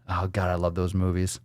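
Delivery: usual speaking voice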